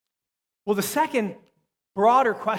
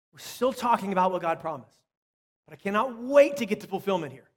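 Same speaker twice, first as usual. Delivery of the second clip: ordinary talk, nothing marked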